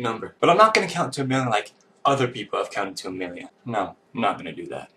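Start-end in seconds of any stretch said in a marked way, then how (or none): none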